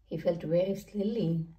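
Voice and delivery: usual speaking voice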